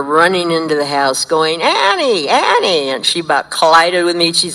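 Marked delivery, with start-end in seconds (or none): 1.6-2.9 bursting in, calling for the mentor; excited calling. Retold or imitated. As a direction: call her name like you just found something. Running in calling her mentor.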